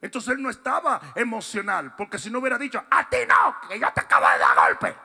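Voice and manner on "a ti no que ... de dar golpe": sudden loud scolding snap; hammed-up furious scold; mock angry rebuke. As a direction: bark it like a fed-up scolding. Jesus voiced scolding, acted.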